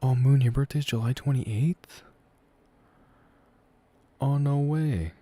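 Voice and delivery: amazed, pitch jumping up; pleasantly surprised. Real, not acted. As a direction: react with real surprise at a coincidence. Learns chatter's birthday is nearly his.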